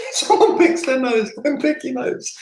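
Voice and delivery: very high, silly squeaky voice; jokey squeal. Pretend. Coach joking about what not to copy, silly high squeal.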